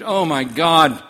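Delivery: loud, slow anguished cry; retold scream. Father finding his dead nephew, a loud slowed cry. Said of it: "and screamed".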